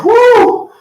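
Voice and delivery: high whooping cheer; excited whoop. Real, not acted. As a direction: let out a big whoop. Coach cheers after a set.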